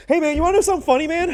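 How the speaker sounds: put-on high, loud goofy kid-brother voice; mimicking a giddy younger brother; imitating little brother. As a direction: ham up the little brother's excitement.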